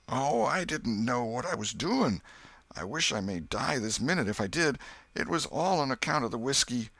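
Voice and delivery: put-on high, begging drunk's voice; desperate pleading. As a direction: plead for your life, voice lifted. Potter begs Joe not to tell.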